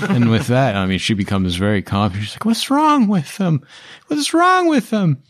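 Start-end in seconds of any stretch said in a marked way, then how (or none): 2.4-5.2 very high, squeaky little halfling voice; halfling Amelia. Acted. GM voicing the halfling urging the party inside, high and squeaky. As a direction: squeak it out in a tiny urgent voice. Amelia asks about the bodies.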